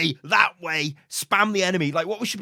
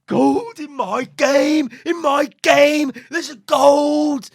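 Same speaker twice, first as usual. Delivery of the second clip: high, loud, drawn-out mock horror; mock outrage; 12 semitones higher, 8 dB louder, much slower than usual. Platinum player spotting a gold in game, mock horror. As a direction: wail it slowly in hammed-up shock.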